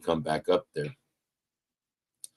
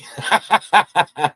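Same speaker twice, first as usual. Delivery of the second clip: burst of laughter; 10 semitones higher, 8 dB louder than usual. Real, not acted. Laugh at own comeback.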